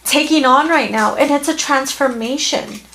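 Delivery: high, tearful voice on the edge of breaking; fighting back tears while reassuring them; choked up. Real, not acted. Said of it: "Sorry, I got a little emotional".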